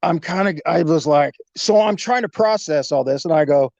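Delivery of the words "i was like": normal talking voice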